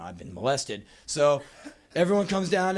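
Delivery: usual speaking voice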